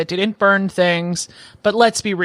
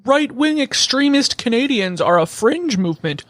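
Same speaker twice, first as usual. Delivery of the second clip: higher-pitched mock outrage; mocking media imitation; 6 semitones higher than usual. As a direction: parody scandalized commentators, pitched up. Mocks left-wing media in quote.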